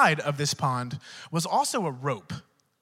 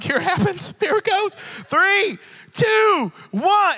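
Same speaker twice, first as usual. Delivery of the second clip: higher, rising tense count; nervous countdown; 12 semitones higher, 8 dB louder, slower than usual. Retold or imitated. Grandson counting down in canoe, tense. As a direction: count down bracing yourself.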